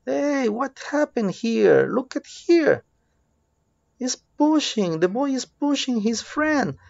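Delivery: high-pitched, play-acted shock; mock alarm. Teacher spotting a boy pushing his friend, mock alarmed. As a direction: react as if catching a child misbehaving, voice way up.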